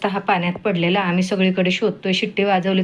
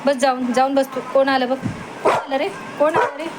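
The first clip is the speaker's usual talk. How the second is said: high excited talk to her dog at feeding time; high pet-talk; 6 semitones higher than usual. Real, not acted.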